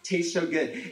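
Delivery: ordinary talk, nothing marked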